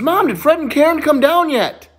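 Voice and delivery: put-on high, excited child's question; eager little boy. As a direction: call to Mom as you run in. Boy runs in asking his mom.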